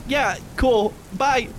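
high, hurried and awkward goodbye; nervous brush-off. Acted. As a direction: back away politely and bolt. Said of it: "And then he just goes".

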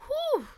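high, sharp whew; loud, high sigh of release after crying; composing exhale. Real, not acted. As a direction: blow out a big breath to pull yourself together. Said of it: "I'm composing myself".

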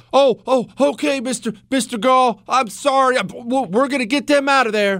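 hammed-up frightened official saying sorry; mock cowering Roman. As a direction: play the Roman grovelling in mock panic. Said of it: "What do you think Rome would have done?".

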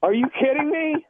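high-pitched stunned disbelief; can't believe it's a prank. Real, not acted. Victim learns the caller was pranking him, stunned.

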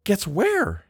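high, lilting happy question. A narrator's reading. Guest asking where she is being sent, happy. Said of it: "she asked happily".

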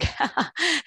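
quick high-pitched laugh; short laugh. Real, not acted. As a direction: laugh lightly at your own joke. Host laughs at own joke.

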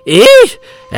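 sudden high loud cry of alarm; a high, loud flustered old man's cry; startled protest. Acted. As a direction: yelp it out, hands waving. Old man waves hands protesting.

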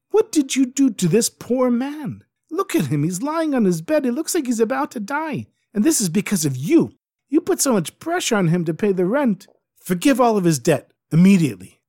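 high, loud indignant scolding. Acted. Noblewoman berates her husband over the dying innkeeper. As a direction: tear into him for his cruelty.

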